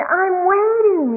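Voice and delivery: high, loud, drawn-out mock complaint; frustrated impatience. Cartoon boy waiting on the sky, exasperated mock wail. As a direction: stretch it out, impatiently hammed up. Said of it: "he's getting really frustrated".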